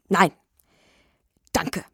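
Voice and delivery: understated indignant refusal. A narrator's reading. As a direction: refuse curtly, a touch offended. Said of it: "sagte Nikano Iwanowitsch schon sehr empört". (verhalten empört)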